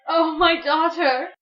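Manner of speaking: cried out. Acted. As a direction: cry it out with relief. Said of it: "Demeter cried".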